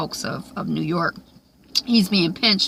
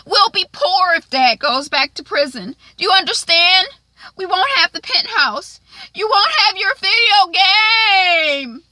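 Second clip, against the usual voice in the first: higher and louder, building to a tearful cry; desperate pleading girl; 14 semitones higher, 6 dB louder than usual. Acted. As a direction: beg your brother until the voice breaks into tears. Sister pleading, growing desperate.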